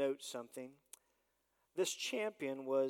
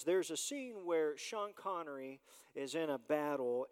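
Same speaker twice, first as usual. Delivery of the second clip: usual speaking voice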